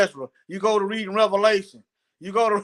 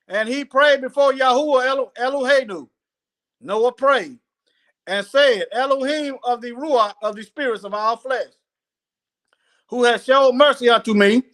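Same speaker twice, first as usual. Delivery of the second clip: raised, deliberate reading voice; scripture reading; 4 semitones higher than usual. Acted. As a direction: read it out phrase by phrase. Reads Noah's prayer aloud.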